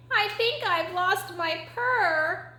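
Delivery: high, slow kitten voice; slow, high-pitched young kitten; little kitten. Acted. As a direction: confide it like a small lost kitten. Kitten tells mother of loss.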